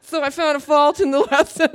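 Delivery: laughing loudly mid-sentence. Real, not acted. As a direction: crack up while telling it. Laughing at her own story.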